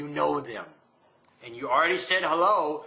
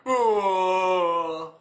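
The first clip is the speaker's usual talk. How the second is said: long, drawn-out comic moan; mock moan; 2 semitones higher, 4 dB louder than usual. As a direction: let out a hammed-up groan of grief.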